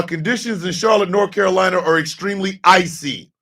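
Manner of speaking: ordinary delivery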